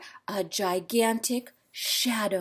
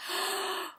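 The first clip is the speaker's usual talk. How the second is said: sharp gasp; 10 dB quieter than usual. Real, not acted. Reader reacts to stabbing.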